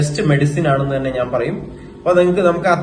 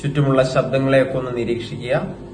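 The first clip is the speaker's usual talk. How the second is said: guided meditation; 3 semitones lower, 6 dB quieter than usual. Acted. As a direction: guide them into stillness, phrase by phrase, in an even tone. (ധ്യാനത്തിലേക്ക് നയിക്കുന്ന സാവധാന ശബ്ദം)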